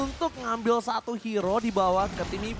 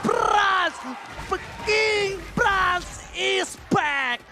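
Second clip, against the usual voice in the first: triumphant shout; 5 semitones higher, much slower than usual. Acted. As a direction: roar the comeback slowly. Victory call. (teriakan kemenangan panjang)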